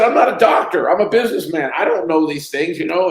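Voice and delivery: very high, loud, pleading I'm not a doctor; retold defence to the doctor, high and loud; exasperated protest. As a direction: throw your hands up in your voice.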